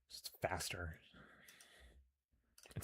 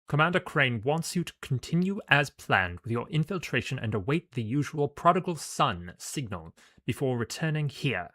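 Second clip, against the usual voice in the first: higher, louder soldier voice; clipped soldier; 6 semitones higher, 8 dB louder than usual. Acted. Soldier relays the commander's orders to the agent, clipped. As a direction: rap out the orders in clipped tones. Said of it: "in clipped tones says".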